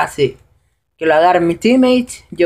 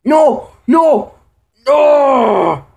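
real despairing shout; 7 semitones higher, much slower than usual. Real, not acted. Gamer yells at the screen as his team loses, despairing. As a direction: cry out as the round slips away.

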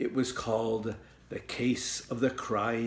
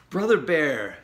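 higher, louder impatient call; 4 semitones higher, 5 dB louder than usual. Acted. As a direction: call out to her brother, losing patience. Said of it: "said Sister impatiently".

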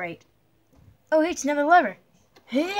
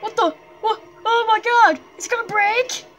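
loud high panicked yells; panicked shouting; 10 semitones higher, 7 dB louder than usual. Real, not acted. Player on a breaking bridge, panicked high shouts. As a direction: shout in real alarm as it breaks. Bridge collapsing under him.

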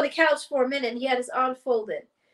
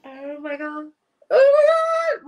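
retold embarrassment; 5 dB louder, slower than usual. Recalling wanting to crawl out of the room, embarrassed. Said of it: "'cause I was just embarrassed".

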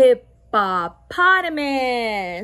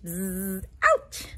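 buzz and ouch; 5 semitones lower, 6 dB quieter than usual. Pretend. Jellyfish sting acted.

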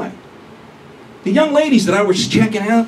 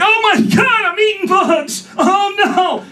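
mock panicked shriek; 10 semitones higher than usual. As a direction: squeal it like a girlfriend panicking over bugs. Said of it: "She starts freaking out".

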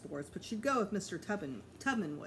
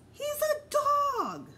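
exasperated; 8 dB louder, slower than usual. A narrator's reading. Peter exasperated at Fudge.

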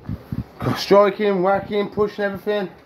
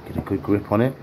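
ordinary talk

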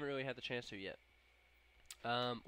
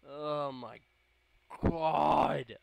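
building to a loud wail; loud cry of dismay; exasperated outburst; 4 semitones higher, 13 dB louder, much slower than usual. Real, not acted. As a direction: explode on the last word. Reacts to own mistake.

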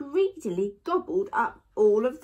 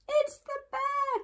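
very high squeal without extra volume; alarmed cry. A narrator's reading. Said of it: "cried Eddie".

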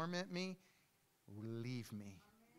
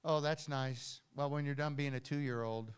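ordinary delivery

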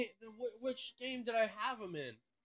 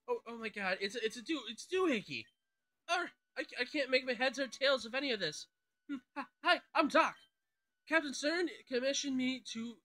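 higher-pitched flustered, stuttering shipbuilder; stuttering commentary; 4 semitones higher than usual. Acted. Flustered shipbuilder fretting over his ferry design, stuttering. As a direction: trip over your words, restarting in a higher voice. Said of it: "making stuttering, like, stuttering commentary".

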